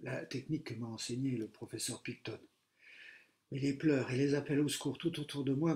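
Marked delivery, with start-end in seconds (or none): none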